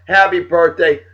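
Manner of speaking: normal talking voice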